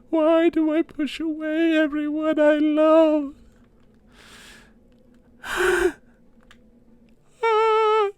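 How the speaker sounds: high, slow melodramatic sobbing; fake crying. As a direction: wail like a self-pitying mother. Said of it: "I'm real crying now".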